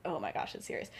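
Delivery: low, soft stunned whisper; hushed gasp. Retold or imitated. James finding Sirius in the dark, hushed and stunned. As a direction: breathe it out in shock. James recognizes hurt friend.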